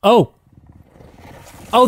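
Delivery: usual speaking voice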